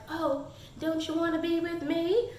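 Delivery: soft, teasing mock-sweet invite; mock seductive. Imitating the love interest coaxing her, soft mock seduction. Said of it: "he's like".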